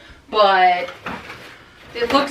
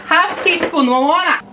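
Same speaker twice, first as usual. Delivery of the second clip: higher-pitched mock yell in a put-on accent; 5 semitones higher than usual. Baker calls out measure and name, mock yelling. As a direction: call out the measure with playful force. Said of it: "That's why I'm yelling at their names".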